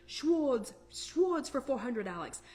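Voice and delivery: badly faked Scottish slur, dropping on the last name; Sean Connery impression. Retelling Connery's category pick to the group, imitated Scottish accent. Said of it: "kind of a Scottish accent. And he goes".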